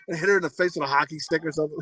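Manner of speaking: ordinary talk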